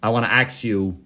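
"ax" said with ks where ask has sk. Retold or imitated. Host quotes the man in court, ask turned to AX. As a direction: imitate him saying AX, like the tool. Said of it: "or is he saying ax".

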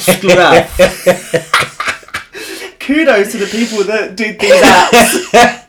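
cracking up while talking; laughing. Real, not acted. Cracking up over the app names.

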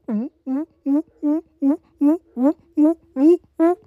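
high, repeated pigeon hoots; pigeon imitation. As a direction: hoot in short rising bursts like a pigeon. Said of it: "The pigeon is just going".

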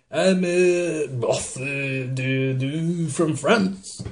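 halting, slowed mock French with a lift at the end; over-the-top French accent; hammy French accent. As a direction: fumble through it like a bad French impression. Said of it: "hammy French accent".

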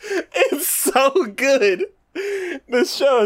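slow, high, laughing gush; giggling delight about the show. Real, not acted. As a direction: savor it through the laughter. Gushing after laughing.

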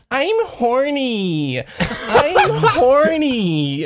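drawn-out, high mock whine; whiny. Friend calling out behind her on the street, mock whiny. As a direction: whine it slowly, imitating a friend. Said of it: "in a really whiny voice".

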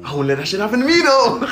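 high, rising indignant declaration; emphatic refusal. Real, not acted. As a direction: pitch climbing high towards the end. Loudly refusing the hypothetical.

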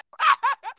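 high laugh. Real, not acted. Laughing at friend's answer.

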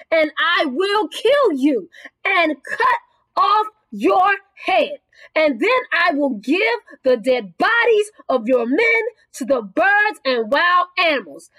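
deliberate, weighty declamation with long stressed words; fervent preaching. Acted. David vowing to conquer his enemy, fervent preaching. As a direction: declare it as a victory, phrase by phrase.

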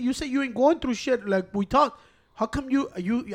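ordinary talk, nothing marked

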